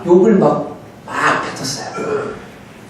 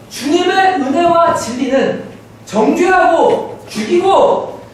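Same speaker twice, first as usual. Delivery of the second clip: impassioned preaching; 9 semitones higher than usual. Acted. Pastor urging the congregation on grace and truth, impassioned preaching. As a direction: preach it high, stretching the key phrases.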